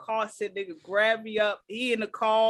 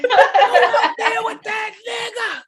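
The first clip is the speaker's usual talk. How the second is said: much higher and louder, laughing through it; laughing crowd-style hype; hyped laughing yell; 10 semitones higher, 8 dB louder, slower than usual. Real, not acted. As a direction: egg it on like a cheering crowd. Hyping like a crowd.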